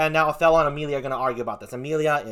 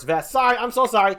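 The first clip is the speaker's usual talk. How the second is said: ordinary talk, nothing marked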